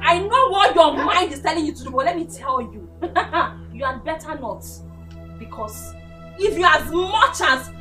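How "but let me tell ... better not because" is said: low, soft menace with a laugh; quiet threat. Acted. Woman warning her opponent not to try it, quiet menace. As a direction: warn them in a lowered, softened voice.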